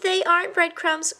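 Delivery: high little squeak. A narrator's reading. A guilty mouse squeaks the truth. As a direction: squeak it out meekly. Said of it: "squeaked Christopher".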